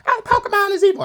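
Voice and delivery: squeaky high mock gasp; mocking parents. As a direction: parody the parents' shocked belief. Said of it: "My parents were like".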